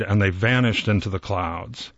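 ordinary delivery, nothing marked

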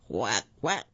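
lightly voiced duck quack. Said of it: "quacked the duck".

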